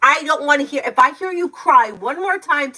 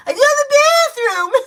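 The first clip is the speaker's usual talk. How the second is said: much higher, louder mocking whine; mock whiny child; 7 dB louder than usual. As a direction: parody the child's complaint. Mother mimics child's whine.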